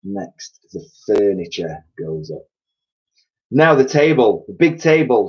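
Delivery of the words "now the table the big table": louder, higher, urgent command; shouted order. A narrator's reading. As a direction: call out the next job forcefully. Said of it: "shouted Mugglewump".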